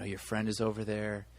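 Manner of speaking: hushed imitation of a director coaxing; imitating gentle director. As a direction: quietly break bad news to a little girl. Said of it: "talking to her".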